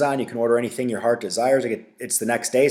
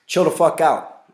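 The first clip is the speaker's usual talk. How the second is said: higher, louder scolding; exasperated command; 5 semitones higher, 4 dB louder than usual. Real, not acted. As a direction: tell the whole panicking crowd off. Ranting at panic buyers.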